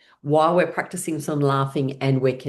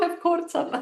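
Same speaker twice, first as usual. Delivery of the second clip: giggly. Real, not acted. Playful laughter game.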